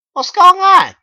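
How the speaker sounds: very high, loud squeal; squeaky narrator voice. A narrator's reading. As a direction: shriek the question. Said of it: "I asked them".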